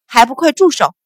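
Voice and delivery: loud, angry command; furious mistress breaking up a fight; angry shout. A narrator's reading. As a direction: bark it out in anger. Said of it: "怒声喝道".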